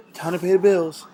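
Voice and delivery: loud, insistent repeated shout; loud wake-up call. Real, not acted. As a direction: holler it to rouse the sleepers. Waking sleepers, chanting bills are due.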